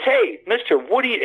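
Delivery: high-pitched mock teen shout; calling out like a teenage kid on a motorbike; a hint of a teenager yelling across the sand; retold kid yelling. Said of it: "he yells at me".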